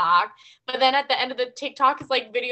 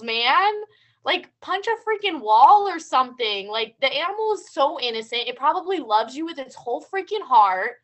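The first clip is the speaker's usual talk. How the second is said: normal talking voice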